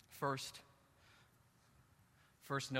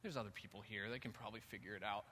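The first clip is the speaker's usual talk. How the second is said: mock timid hedging; 7 dB quieter than usual. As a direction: mumble your way out of helping. Doctor hanging back in the room.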